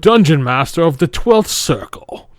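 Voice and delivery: pompous dungeon master proclamation; manly voice. Acted. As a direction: announce yourself like a grand master. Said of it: "do it in a manly voice".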